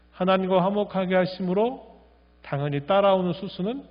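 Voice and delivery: ordinary talk, nothing marked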